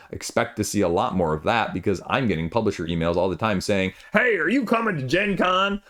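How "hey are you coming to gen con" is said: put-on old cowboy accent. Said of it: "I went with a sort of old cowboy accent there".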